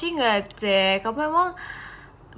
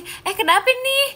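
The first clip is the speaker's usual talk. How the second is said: surprised; 12 semitones higher, 4 dB louder than usual. Real, not acted. Surprised at viewer's comment.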